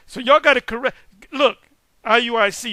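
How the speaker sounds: "did you hear that?", loud and stirred up; excited call-out. Real, not acted. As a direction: call the room's attention, loud and high. Correcting the listeners.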